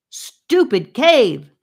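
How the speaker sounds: loud, slow, furious shout; angry yell. A narrator's reading. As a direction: hurl it at the cave wall. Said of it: "he yelled".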